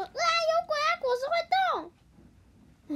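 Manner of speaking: high, loud terrified yelp; scared. Acted. Hippo startled by falling fruit, frightened shriek. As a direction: shriek like the fruit just moved. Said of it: "河马就吓得说".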